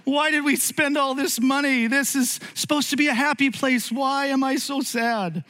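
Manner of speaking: high, whiny mock lament; hammed-up tearful complaining, pitched high; miserable whining. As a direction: wail it like a fed-up parent at a theme park. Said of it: "crying their eyes out, going".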